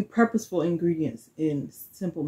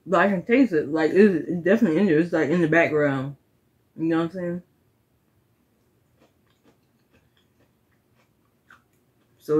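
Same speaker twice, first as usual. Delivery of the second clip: talking behind hand; muffled through fingers; mouth full, chewing; 3 semitones lower, faster than usual. Real, not acted. Seen 0: Hand covers mouth throughout; bit cookie at -5, talking while chewing.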